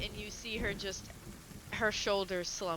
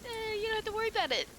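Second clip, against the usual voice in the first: squeaky imitation, higher and quicker; high mock quote; much faster than usual. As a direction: mimic her breezy dismissal. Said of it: "she's the one who was like".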